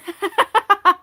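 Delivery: high, loud burst of laughter. Real, not acted. Reader cracking up at her own lasagna joke, loud laugh. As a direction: laugh out at the pun.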